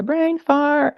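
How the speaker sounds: losing her thread on a livestream, self-mocking squeak; squeaky brain fart. Real, not acted. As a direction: laugh at your own blank moment. Losing her train of thought again.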